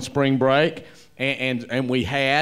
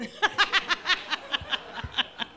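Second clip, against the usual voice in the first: breathless high laugh; 7 dB quieter than usual. Real, not acted. Laughs at own story.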